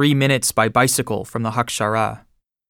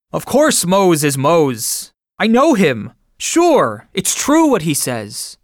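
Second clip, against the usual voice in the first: firm declaration; 5 semitones higher, slower than usual. A narrator's reading. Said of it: "Von der Berg declared".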